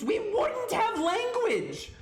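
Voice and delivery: high-pitched, indignant outburst; exasperated rant. Real, not acted. Streamer defending mispronunciations against people who hate them, exasperated. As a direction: climb high in sheer exasperation.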